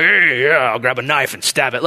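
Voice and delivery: low-key macho bravado; mock masculine response. Preacher denying he was the manly hero, mock macho. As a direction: play at being the tough guy. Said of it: "my response wasn't the most masculine".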